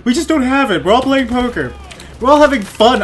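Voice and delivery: high, slightly loud put-on voice; acted mocking reply. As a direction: call back in a silly high voice. Voices the soldiers answering Billy.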